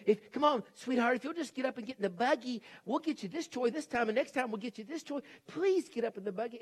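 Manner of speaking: pleading parent. Retold or imitated. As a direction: imitate a parent bargaining with a small child. Parent bargaining with screaming child.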